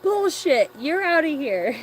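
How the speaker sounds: high, loud fake referee call; mock outraged shout. Bingo host yelling at a false bingo call, loud and high. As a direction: throw them out of the bingo hall.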